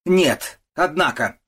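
loud, high outburst; angry shouting. A narrator's reading. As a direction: cry out in indignation. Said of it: "кричит госпожа Мюршель". (возмущённый крик)

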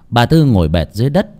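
ordinary delivery

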